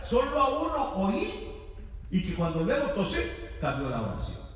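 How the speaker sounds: usual speaking voice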